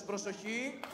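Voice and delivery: a soft, clipped drill call of attention; mock sergeant command. Shown as a demonstration. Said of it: "όλος ο λόγος".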